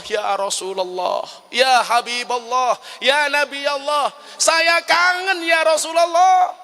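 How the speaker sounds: slow plea climbing steadily to a high, loud wail; acted tearful calling, drawn out and swelling; anguished, longing cry. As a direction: call out to the beloved, building with each phrase. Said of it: "Dengan suara parau dia mengatakan".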